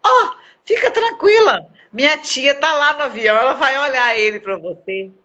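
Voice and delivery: put-on little girl reassuring the crying mother, high child voice; little girl's voice. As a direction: play the small girl comforting, voice breaking. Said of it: "Aí ela virou e falou assim".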